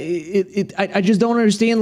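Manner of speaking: normal talking voice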